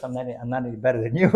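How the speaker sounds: fast, laughing reply. Retold or imitated. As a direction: laugh it off quickly. Zusha reassuring, laughing.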